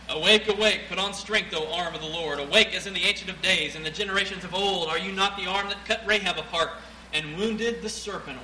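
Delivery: loud, booming scripture reading; preaching at full force. Acted. Preacher reading Isaiah to his congregation, booming. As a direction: proclaim it to the back pew.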